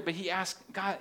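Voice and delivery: ordinary talk, nothing marked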